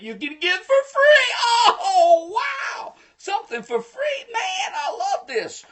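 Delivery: high, excited shout; gleeful hype. Pretend. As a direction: squeal with delight over a freebie. Hyping a free giveaway to viewers.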